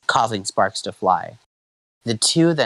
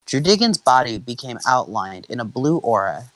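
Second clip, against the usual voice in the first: usual speaking voice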